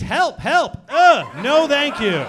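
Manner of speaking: high, loud mock plea, laughing through it; mock cry for help. Comic cries for help to the crowd, then a laugh.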